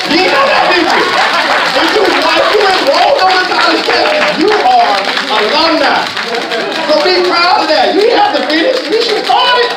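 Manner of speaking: loud, high preacher's shout; preaching at full force. Real, not acted. Preacher shouting to the audience about being alumni, fired up. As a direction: whip the crowd up with it.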